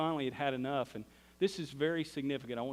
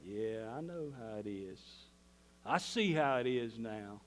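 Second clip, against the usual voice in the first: slow mock-southern needling; southern sarcasm; slower than usual. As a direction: tease him with a southern drawl. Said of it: "we're getting southern again".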